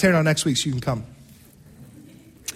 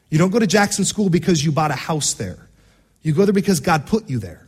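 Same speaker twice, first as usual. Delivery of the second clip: ordinary talk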